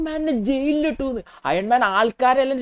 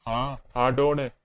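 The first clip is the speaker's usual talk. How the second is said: Hulk voice; 9 semitones lower, much slower than usual. Acted.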